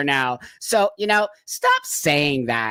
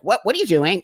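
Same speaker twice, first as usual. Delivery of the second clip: confrontation; 6 semitones higher than usual. Retold or imitated. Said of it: "I totally was like".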